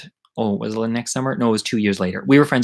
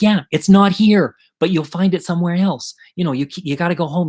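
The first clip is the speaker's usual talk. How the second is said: high, raised-voice retold exasperation; exasperated coaching; 6 semitones higher, 4 dB louder than usual. Kid feeds friend a cover story, exasperated.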